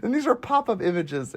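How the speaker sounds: ordinary talk, nothing marked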